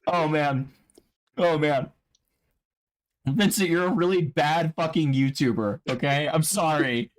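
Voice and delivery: high, tearful voice from pepper burn; crying while talking. Real, not acted. Streamer insulting a rival while eating hot nuts, tearful. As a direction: apologize through real watering eyes. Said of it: "You sound like you're crying".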